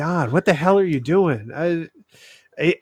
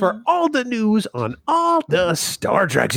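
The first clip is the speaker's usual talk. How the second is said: higher, slow trailer-voice read; mock announcer; 6 semitones higher, slower than usual. Co-host announcing the news segment in a mock trailer voice.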